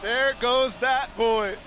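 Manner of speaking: slow, loud, high hype line; hyped. Real, not acted. Co-caster hypes the hero.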